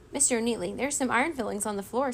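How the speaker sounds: ordinary delivery, nothing marked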